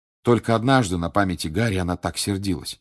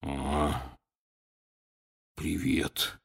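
low, soft, wheezing croak; hoarse croak; 4 semitones lower, 7 dB quieter, much slower than usual. A narrator's reading. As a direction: rasp it out weakly. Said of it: "Хагрид приподнял голову и просипел". (сипло)

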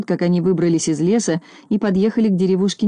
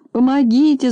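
a high, louder wail of mock panic; lightly voiced policeman howling for help; wailing cry for help; 6 semitones higher, 3 dB louder than usual. A narrator's reading. Policeman dangling in a tree wails for help, high and frightened. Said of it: "завыл полицейский".

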